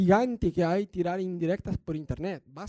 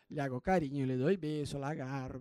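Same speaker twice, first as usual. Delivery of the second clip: suave, como con la bebé; 5 semitones lower, 8 dB quieter than usual. Retold or imitated. Cuenta cómo acaricia a la bebé.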